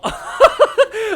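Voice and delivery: high, loud laugh; burst of laughter. Real, not acted. Host watching slow motion, laughing hard. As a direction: crack up.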